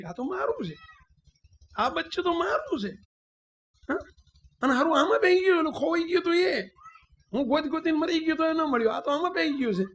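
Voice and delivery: high, excited lion's voice at finding his lost cub; lion's delight at finding cub. Retold or imitated. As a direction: exclaim with joy over a found child.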